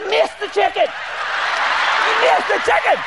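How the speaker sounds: high-pitched amazed report, drawing out the repeat; excited storytelling. Retold or imitated. Preacher telling how grandpa's hatchet missed, amazed. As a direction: crow it in disbelief, stretching the words.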